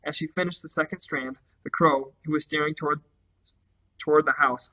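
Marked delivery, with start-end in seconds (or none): none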